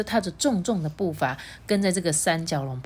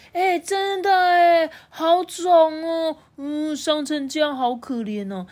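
put-on pitying child voice; 9 semitones higher than usual. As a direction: feel sorry for the hurt uncle. Baby pities T-rex's tail.

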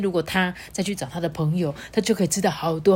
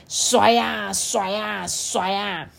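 slow shake; 3 semitones higher, much slower than usual. A narrator's reading. Shaking the trunk.

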